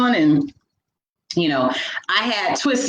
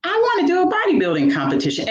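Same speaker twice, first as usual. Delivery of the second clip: high and fast, bursting with eagerness; eager decision; 11 semitones higher, much faster than usual. Retold or imitated. Woman telling herself her new goal, high and quick with excitement. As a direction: blurt it out all fired up. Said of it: "I was like".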